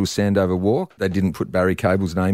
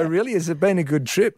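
mock surprise; 8 semitones higher than usual. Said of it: "I go".

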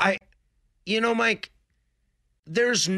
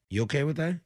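usual speaking voice

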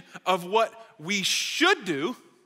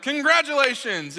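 higher, stretched-out mock congratulations; announcer congratulating; 6 semitones higher, 3 dB louder than usual. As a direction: congratulate a kid like a store announcer. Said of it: "And they were like".

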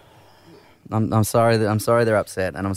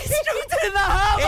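high, crying-out dismay; distraught cry; 12 semitones higher than usual. Real, not acted. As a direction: cry out in shock. Ring dropped in harbour.

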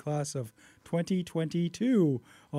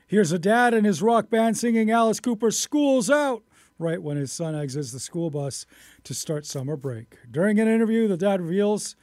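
radio news story, lifted at the start; lively news reader introducing a clip; 3 semitones higher, 7 dB louder, faster than usual. Acted. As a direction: open strong, then settle into the news read.

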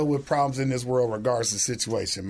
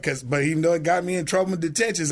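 ordinary talk, nothing marked

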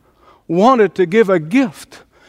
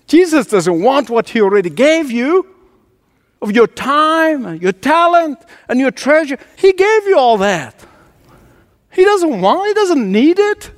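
high-pitched, insistent sermon delivery; impassioned preaching; 8 semitones higher than usual. Real, not acted. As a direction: preach it urgently, lifting each item. Preacher presses the point.

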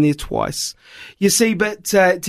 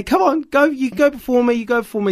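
polite churchgoers; 5 semitones higher than usual. Retold or imitated.

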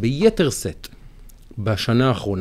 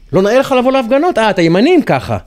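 higher, louder, rapid scolding; mock accusing reproach; 7 semitones higher, 5 dB louder than usual. Voicing people who pressure his friend to join demonstrations, mock accusation.

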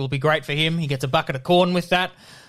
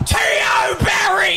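high, drawn-out player's name; gasp, then holler the hero's name; excited commentator shout; 13 semitones higher than usual. Acted. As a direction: ecstatic play-by-play cry.

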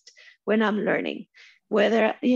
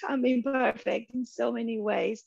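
usual speaking voice

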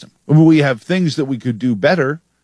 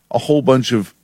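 ordinary talk, nothing marked

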